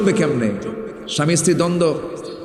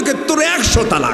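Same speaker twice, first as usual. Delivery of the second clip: high-pitched mock rage; imitated husband's heated, high-pitched outburst; retold angry outburst; 9 semitones higher than usual. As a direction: act out a husband blurting divorce in anger. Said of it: "রাগের ঠেলায় কইছে".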